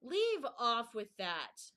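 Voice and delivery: loud, slow, screamed rebuke; queen screaming at the bowing gardeners. A narrator's reading. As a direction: scream it in royal irritation. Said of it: "screamed the queen".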